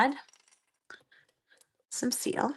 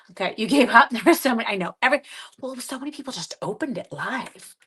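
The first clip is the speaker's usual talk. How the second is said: normal talking voice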